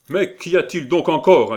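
loud, high call from inside; irritated interruption. A narrator's reading. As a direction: burst in, fed up with the racket. A third man interrupts from inside. (interruption agacée, voix forte)

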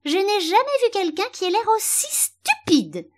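very high, loud little violet; sudden squeaky, scornful flower voice; abrupt outburst. A narrator's reading. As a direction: blurt out the insult in a piercing squeal. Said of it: "si brusquement qu'Alice sursauta".

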